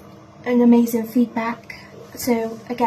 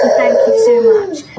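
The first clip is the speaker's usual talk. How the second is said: choked up, pushing out the gratitude; real emotional break; tearful thanks; 12 semitones higher, 9 dB louder than usual. Real, not acted. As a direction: voice cracking high and loud on the thanks.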